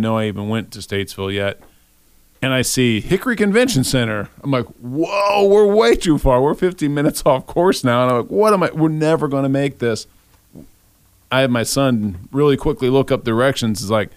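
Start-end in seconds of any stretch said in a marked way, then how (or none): 4.8-10.1 alarmed exclamation. Retold or imitated. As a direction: yelp it out in sudden alarm, pitch jumping up. Realizing they drove too far.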